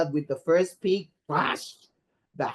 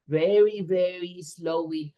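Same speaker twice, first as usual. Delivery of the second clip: slow, drawn-out cue; teacher cueing the girls to make a slow wind. Shown as a demonstration. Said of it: "So when you hear something about".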